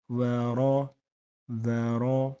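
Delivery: ورا with a heavy, full ر; bold ra. Shown as a demonstration. Teacher showing the heavy ra. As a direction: say ورا with a thick ر. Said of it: "را کو ایسے bold کریں گے".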